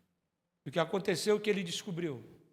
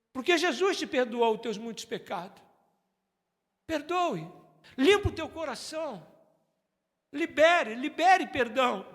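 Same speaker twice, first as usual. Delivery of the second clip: preaching appeal; 4 semitones higher than usual. Acted. Pastor urging the church to forgive, building plea. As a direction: urge them to forgive. Altar call exhortation.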